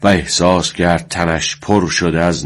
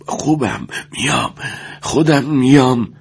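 straining breath; 7 semitones higher than usual. A narrator's reading. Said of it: "با تمام نفسش گفت".

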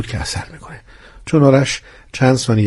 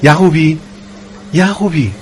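raised, higher-pitched call to a colleague; calling out loudly; 6 semitones higher, 4 dB louder than usual. A narrator's reading. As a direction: call his name urgently across a noisy hall. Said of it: "با صدای بلند گفت". (با صدای بلند صدا زدن)

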